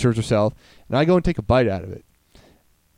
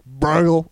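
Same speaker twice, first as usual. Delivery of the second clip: imitated gurgling food noise; five-year-old spit-out; 3 semitones higher than usual. Showing how a five-year-old dumps food, mimicked gurgle. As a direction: mimic a child letting food fall out. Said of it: "do the five-year-old thing".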